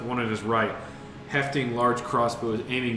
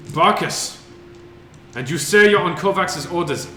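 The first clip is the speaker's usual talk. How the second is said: guard shouting down; 5 semitones higher, 8 dB louder than usual. Acted. Guard recognizes them from wall.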